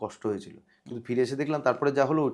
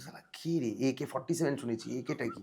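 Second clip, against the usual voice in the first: puzzled muttering; 9 dB quieter than usual. Retold or imitated. Actor retelling his reaction to an unknown caller ID, puzzled muttering. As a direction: puzzle over it quietly, half to yourself.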